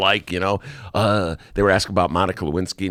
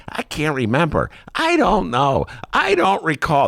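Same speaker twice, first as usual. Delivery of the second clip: stonewall the questions, playing dumb; Daley impression; 8 semitones higher than usual. Host imitating Mayor Daley testifying, evasive and higher. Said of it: "Stellar Daley impression".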